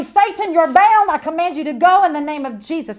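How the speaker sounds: shouted rebuke of Satan in Jesus' name; commanding rebuke. Shown as a demonstration. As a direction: cast him out with full authority.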